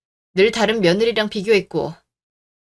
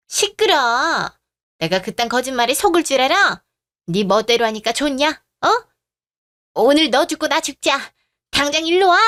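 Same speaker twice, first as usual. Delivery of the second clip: raised, higher-pitched angry tirade; furious scolding; 4 semitones higher, 3 dB louder than usual. Acted. Mother-in-law raging at a daughter-in-law over a missed holiday, furious. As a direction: tear into her, rising towards a shout.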